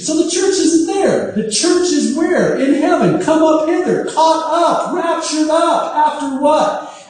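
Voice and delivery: soaring, joyful pulpit climax; excited preaching. Real, not acted. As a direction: lift it up in exultant proclamation. Preacher builds to rapture climax.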